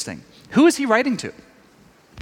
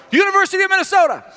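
fast mock shout, much higher; player shouting back; 13 semitones higher, 4 dB louder, faster than usual. A player shouts his college back to the coach, fast and high. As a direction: blurt it out like a tired player.

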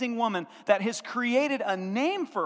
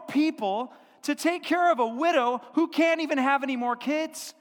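louder, higher, gushing amazement; amazed townsfolk; 5 semitones higher, 5 dB louder than usual. Retold or imitated. Townspeople marvel at Ruth who left her people, loud and awed. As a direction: gasp it out like the whole town is in awe.

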